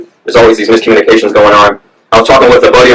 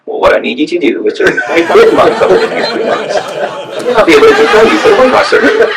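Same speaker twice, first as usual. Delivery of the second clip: strained, higher-pitched old doctor impression; old man doctor; 6 semitones higher than usual. Weight loss doctor answering a surgery patient, old man impression. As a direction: mimic a hunched-over old surgeon. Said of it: "really, you know, old man, hunched over, squinty eyes".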